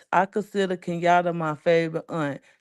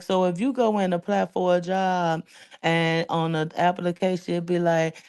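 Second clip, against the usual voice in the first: ordinary talk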